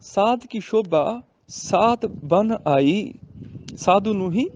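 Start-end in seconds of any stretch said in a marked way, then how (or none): 0.0-3.1 very slow verse with ਬਨ apart from ਆਈ; ਬਨ ਆਈ as two words. Shown as a demonstration. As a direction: recite slowly, keeping ਬਨ and ਆਈ separate. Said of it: "ਇਹਨੂੰ ਬਨਿਆਈ ਨਹੀਂ ਬੋਲਣਾ".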